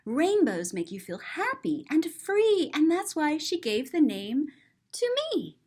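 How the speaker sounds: gentle storybook reading, lilting up at the end; reading to children. A narrator's reading. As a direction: read it to little ones like a picture book. Reading the book's text.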